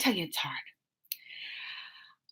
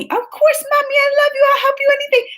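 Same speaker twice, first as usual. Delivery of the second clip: put-on high, sweet little girl voice, much higher; sweet little daughter; 4 dB louder, faster than usual. Mom imitating her sweet daughter saying she loves her, in a high little voice. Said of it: "That's what she said".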